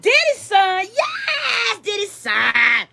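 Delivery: mock shouting, pitch jumping high; excited crowd shout. Club crowd spots [name]'s son, shouting excitedly. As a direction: hype it up like a thrilled clubgoer. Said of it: "They gonna be like".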